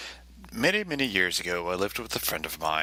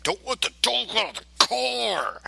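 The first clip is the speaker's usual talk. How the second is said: high, put-on cop yelling; imitating cops fussing over the dog; mock cop shout. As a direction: bark the order like a panicky cop.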